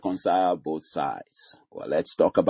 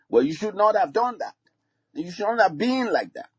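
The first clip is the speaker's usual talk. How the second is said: much higher, mock scolding; scolding parent; 13 semitones higher than usual. Parent scolding a child who went astray, mock scolding. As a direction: wag the finger, hammer-on-the-head scold. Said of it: "Rather than saying".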